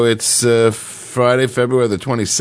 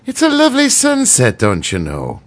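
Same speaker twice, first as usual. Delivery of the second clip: Irish accent; 8 semitones higher than usual. Shown as a demonstration. Showing the special Irish phrase, hammed-up Irish accent. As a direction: sing it up high like a stage Irishman. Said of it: "the special phrase with the Irish people is".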